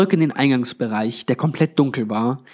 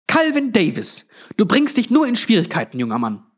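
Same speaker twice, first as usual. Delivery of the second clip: high-pitched scolding granny; fauchend; 9 semitones higher than usual. A narrator's reading. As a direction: reprimand a young man sharply, voice pitched up. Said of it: "fauchte sie". (mit hoher Stimme zurechtweisend)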